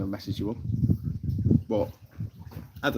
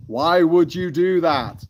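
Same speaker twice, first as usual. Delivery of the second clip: high, loud, slow plea, stretching each word; drawn-out mock anguish; 7 semitones higher, 6 dB louder, slower than usual. Streamer questions God in a joke, drawn-out mock anguish. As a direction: wail it slowly in hammed-up disbelief.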